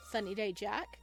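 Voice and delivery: usual speaking voice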